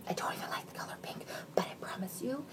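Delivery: soft secretive whisper. Real, not acted. Admitting to viewers she dislikes pink, whispered. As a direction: whisper it as a confession.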